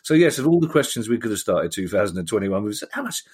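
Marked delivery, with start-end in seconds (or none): 2.9-3.2 a quick South London "How much?"; clipped South London query, high and soft; South London accent. Retold or imitated. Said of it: "I'm sorry I said that in a South London accent".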